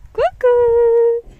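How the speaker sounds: high, loud playful call; bright high ku-ku call; peekaboo call. Real, not acted. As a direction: call out peekaboo to the little one.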